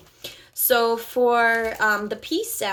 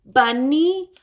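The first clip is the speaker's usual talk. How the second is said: "Bunny" with the B popped, higher and louder; modeling B; 5 semitones higher, 5 dB louder than usual. Shown as a demonstration. Therapist showing a child a clear B. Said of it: "if I were modeling it to them".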